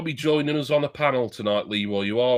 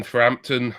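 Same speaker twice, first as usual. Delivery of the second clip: usual speaking voice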